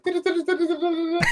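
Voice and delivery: very high, fast la-la babble while laughing; annoy a resting player with a sing-song; silly humming. Retold or imitated. Sitting beside players in the game, silly laughing babble. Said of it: "I'm like".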